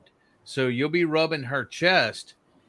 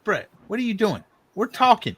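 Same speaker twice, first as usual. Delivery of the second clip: higher and louder, calling the dog off; scolding call; 9 semitones higher, 3 dB louder, faster than usual. Real, not acted. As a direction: snap at the dog to stop. Scolding the dog mid-story.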